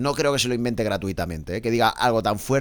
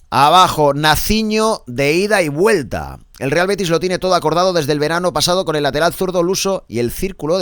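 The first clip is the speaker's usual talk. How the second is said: big announcer delivery with stretched key words; emphatic, projected sports bulletin; sports headline read; 5 semitones higher, 4 dB louder than usual. Acted. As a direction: sell the transfer headline like a radio presenter.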